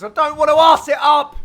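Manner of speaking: loud, high protest as a teammate; mock pleading. Commentator voicing Doug asking them to stop, mock pleading. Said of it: "Doug's like".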